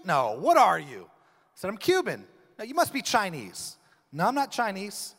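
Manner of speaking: usual speaking voice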